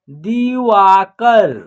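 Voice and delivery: very slow, each syllable apart; slow clear word demo. Shown as a demonstration. Teacher modelling a new word for children, very slow. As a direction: drag the word out for beginners. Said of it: "द में छोटी की मात्रा दी".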